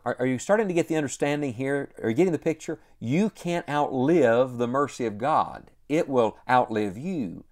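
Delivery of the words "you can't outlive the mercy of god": normal talking voice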